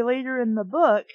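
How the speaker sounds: ordinary delivery, nothing marked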